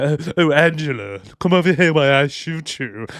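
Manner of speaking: hammed-up posh British accent; mock a bad attempt at a posh accent; put-on posh accent. Podcaster mimicking an actor's bad accent, exaggerated posh voice. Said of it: "putting on a posh accent".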